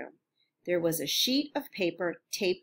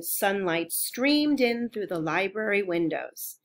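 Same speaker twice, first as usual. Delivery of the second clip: ordinary delivery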